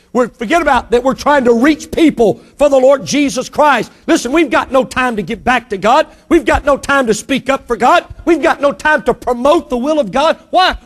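preaching to the congregation, building intensity. Acted. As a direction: build it up from the pulpit.

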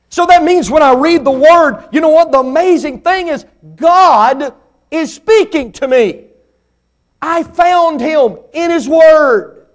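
preacher climbing to a fervent peak; preaching at full force. Real, not acted. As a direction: lift them up, preach it out.

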